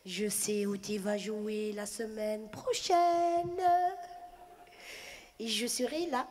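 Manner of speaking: very slow, low menacing promise; lightly voiced lady turning menacing; veiled threat. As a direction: threaten her slowly.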